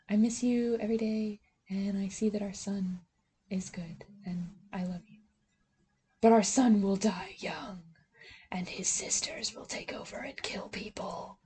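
hushed ghostly voice imitating Jane, fading into a whisper. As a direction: hush it like a ghost giving a warning. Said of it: "she does, like, the voice and everything".

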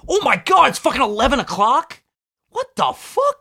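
can't believe the time; shocked. Real, not acted. Shocked it's already eleven.

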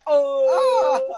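high, loud startled cry; sudden high alarmed yell; startled shout. Real, not acted. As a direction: yelp in shock. Reacting to in-game event.

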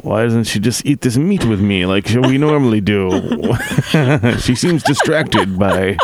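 slowed-down Bob Hope as a caveman. Retold or imitated. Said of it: "my caveman voice, just a slowed down Bob Hope voice".

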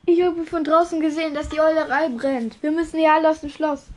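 high, loud, hurried alarm; urgent warning. Acted. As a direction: burst in with the warning. Hagrid bursts in warning of fire.

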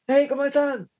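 high, bright hype call to the crowd; stage greeting. Retold or imitated. Singer greeting the crowd from stage, high excited call. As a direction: greet the audience like a showman. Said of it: "Eso sí, yo llegaba".

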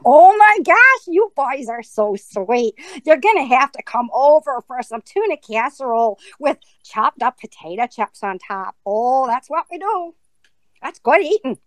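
hammed-up, folksy Minnesota hostess; Minnesota accent. Shown as a demonstration. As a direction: gush like a Minnesotan pressing food on guests. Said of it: "What about Minnesota?".

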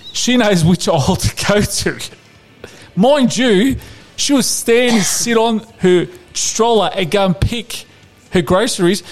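podcast host ranting at a footballer, comically exasperated; mock rant. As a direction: rail at the player like a fed-up fan. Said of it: "this is my rant".

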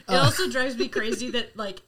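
normal talking voice

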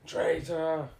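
loud, high accusing shout; shouted accusation. Retold or imitated. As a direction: spit out the word traitor. Villain shouting at the Doctor.

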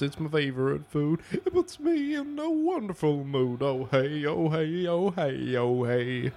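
high silly singsong goat; be a happy goat chanting about hay; cheerful goat ditty, half sung. Pretend. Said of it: "like a billy goat".

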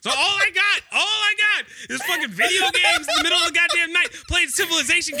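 high, worked-up comic grievance; exasperated rant. Real, not acted. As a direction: protest your lonely night in mock outrage. Comic rant about being left alone.

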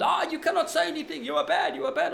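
very high, loud imitation of a raving driver; hammed-up screamed accusation; mock screaming rant. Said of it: "screaming and ranting and raving".

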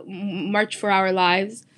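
ordinary talk, nothing marked

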